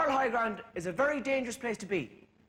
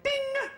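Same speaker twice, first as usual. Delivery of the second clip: high sung ding of a call bell; ping it like the seat bell; bright high bell chime. Retold or imitated. Call button sound.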